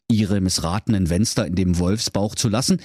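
ordinary delivery

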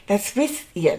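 ordinary talk, nothing marked

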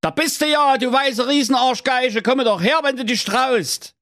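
high taunting call; brave challenge. A narrator's reading. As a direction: dare the giant to come. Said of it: "nahm all seinen Mut zusammen und rief".

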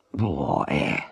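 ordinary delivery

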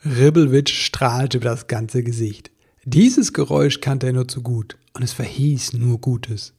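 ordinary delivery, nothing marked

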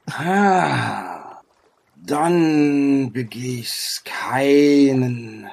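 long sigh, then stretched words; grave ancient sage. Acted. Sage tells travellers their search is in vain, slow and grave. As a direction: draw out each word like an oracle. Game master voices the sage.

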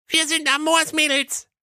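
put-on squeaky high despairing girl; high cartoon voice, doomed; youngest sister calling out. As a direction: wail it high and small. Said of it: "rief die Jüngste".

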